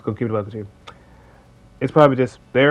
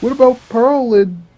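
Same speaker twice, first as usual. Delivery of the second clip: higher-pitched imitation of a nitpicking commenter; pitched-up pretend viewer complaint; mock objector; 7 semitones higher than usual. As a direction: lightly mimic someone butting in to argue. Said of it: "someone's gonna be like".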